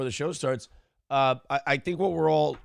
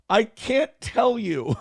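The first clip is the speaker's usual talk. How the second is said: slow, higher-pitched mock helplessness; guilty-sounding evasion; 6 semitones higher, slower than usual. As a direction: imitate a cornered, drawn-out refusal. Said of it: "you sound super guilty".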